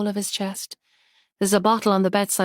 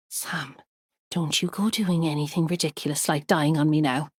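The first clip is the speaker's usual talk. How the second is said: tender; 4 semitones lower, 4 dB quieter than usual. Acted. Holds his hand in ambulance.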